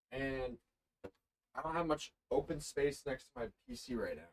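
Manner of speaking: distant, across-the-room voice; talking from across the room; far from mic. Real, not acted. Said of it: "It sounds like you're across the room now".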